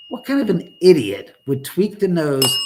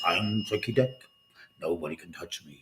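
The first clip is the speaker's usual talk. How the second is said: a light, lower mock Nixon voice; a hint of a Nixon impression, lower and softer; Richard Nixon; 8 semitones lower, 7 dB quieter than usual. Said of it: "used to talk like this".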